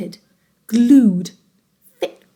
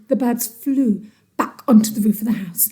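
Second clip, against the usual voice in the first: usual speaking voice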